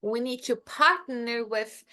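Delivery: We need to partner with said slowly; slow teaching example; example sentence. Shown as a demonstration. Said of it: "a gente precisa fazer parceria".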